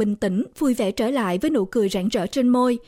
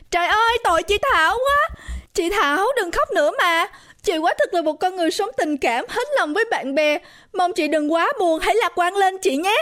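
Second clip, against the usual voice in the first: high, pitying fan voice; sympathetic crowd; 7 semitones higher than usual. A narrator's reading. Guests consoling the weeping birthday girl, sympathetic. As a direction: plead with her to stop crying. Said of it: "trước sự thương cảm của hàng trăm người".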